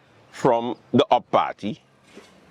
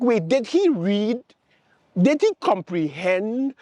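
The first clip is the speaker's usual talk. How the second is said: incredulous; 9 semitones higher than usual. Retold or imitated. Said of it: "And I'm like".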